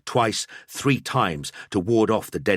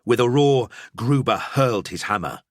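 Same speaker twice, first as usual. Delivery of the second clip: plain reading voice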